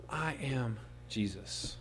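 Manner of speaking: God naming himself to Saul, slow and hushed; solemn. Acted. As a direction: let each word land slowly. Saul hears divine name.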